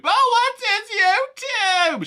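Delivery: high, loud, slow gasp; excited Karen. Retold or imitated. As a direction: squeal with starstruck awe. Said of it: "she goes".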